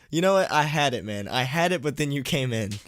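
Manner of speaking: teasing the donor for interrupting; playful mock outrage. Real, not acted. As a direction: blame the donor with a grin.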